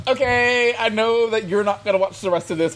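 high, laughing reassurance; placating. Retold or imitated. Retold placating reply to husband.

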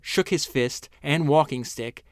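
normal talking voice

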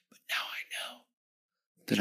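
soft, airy hush; moved whisper. Real, not acted. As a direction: whisper it with conviction. Moved testimony.

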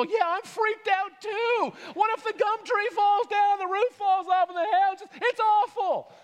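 high, loud mock panic; freaking out. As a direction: wail it in exaggerated alarm. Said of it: "they're freaking out".

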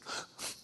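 sharp intake of breath, acted; stunned gasp. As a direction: gasp at unbelievable news. Mary hears the good news.

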